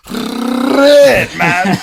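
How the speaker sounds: shrieked-high, loud French R on "red"; exaggerated French accent. Imitating the excited coworker, exaggerated French accent. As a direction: burst into the long French "red" then laugh. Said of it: "but he said".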